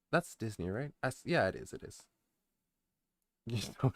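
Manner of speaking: ordinary talk, nothing marked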